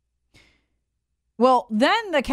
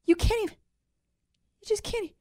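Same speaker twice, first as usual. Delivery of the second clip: mock whine; 11 semitones higher, faster than usual. High mocking exasperation.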